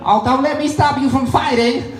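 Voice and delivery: very high mock-Chinese voice; Chinese accent. Chinese astronaut in the corner to arguing rivals, mock Chinese accent. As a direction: interject high-pitched in a caricature Chinese accent. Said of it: "the Chinese guy over in the corner".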